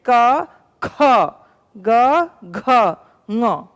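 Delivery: क plain and ख with a strong puff of breath, each letter spaced apart; consonants demonstrated. Shown as a demonstration. Teacher sounding out क, ख, ग, घ for pupils, breath exaggerated. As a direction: sound out क, ख, ग, घ one by one, making the breath of ख and घ heard. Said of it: "अब हम बोल के देखते हैं".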